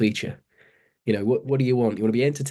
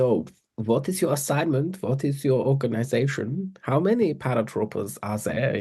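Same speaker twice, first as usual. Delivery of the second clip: a film interpreter asking questions in a mock German accent; 3 semitones higher than usual. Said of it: "giving it the old, the old German accent".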